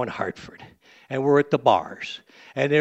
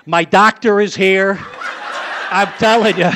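laughing; 5 semitones higher, 6 dB louder than usual. Real, not acted. Laughs at his own joke.

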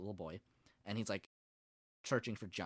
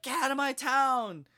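raised, higher-pitched order; mock shout; 7 semitones higher, 6 dB louder than usual. Running him out of town at episode's end, mock shout. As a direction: imitate someone loudly banishing an outcast. Townsfolk driving him out, acted.

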